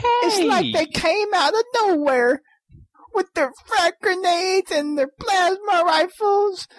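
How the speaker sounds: put-on hillbilly accent. Mock eulogy in accent.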